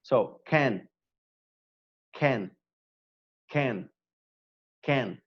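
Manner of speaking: "can" repeated slowly, no T at the end; repetition drill. Shown as a demonstration. Teacher drilling the word for students to repeat. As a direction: drill "can" over and over, calm. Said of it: "If you want to repeat in your house".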